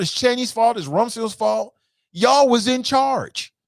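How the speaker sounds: heated blame. Real, not acted. Continuing rant.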